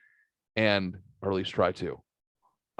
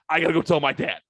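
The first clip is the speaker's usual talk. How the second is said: higher, eager retold announcement; pumped up; 5 semitones higher, 3 dB louder, faster than usual. Pumped-up kid off to tell his dad, excited. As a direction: imitate an excited kid rushing off. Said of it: "gets all pumped up".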